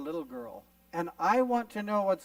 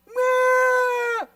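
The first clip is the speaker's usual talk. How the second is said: loud, very high mock baby wail; toddler wail; 11 dB louder than usual. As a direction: bawl like a child robbed of a toy. Acted toddler wailing after losing toy.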